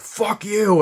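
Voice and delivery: loud, high angry shout; retold threatening yell; acted bully yell. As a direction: burst out like an attacking bully. Acting out bullies jumping out.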